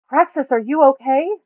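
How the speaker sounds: high, loud worried call across the camp; hollering. Acted. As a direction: call out to a fallen friend. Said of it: "Buma hollers".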